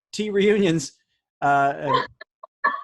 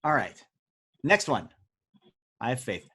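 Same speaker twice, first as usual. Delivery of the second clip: ordinary talk, nothing marked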